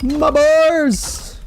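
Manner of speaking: high, loud shocked gasp; shocked cry. Real, not acted. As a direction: yelp of sudden dismay. Sudden loss of his bars.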